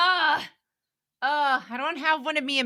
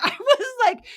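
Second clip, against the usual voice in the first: laughing; 12 semitones higher, 4 dB louder than usual. Real, not acted. Laughing while retelling.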